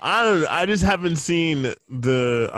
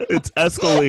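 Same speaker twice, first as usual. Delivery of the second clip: laughing; 4 semitones higher than usual. Real, not acted. Repeats while laughing.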